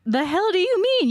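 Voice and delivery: high, mock-baffled challenge; imitating friends' incredulous retort; incredulous friends. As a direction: pitch it up in put-on disbelief. Friends' baffled reaction, acted out.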